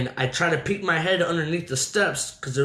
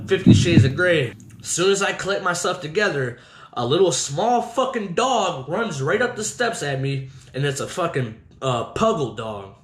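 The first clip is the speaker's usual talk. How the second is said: ordinary delivery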